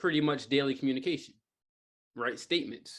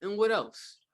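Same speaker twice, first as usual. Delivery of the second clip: curious prompt; 5 semitones higher, slower than usual. Shown as a demonstration. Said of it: "and you go".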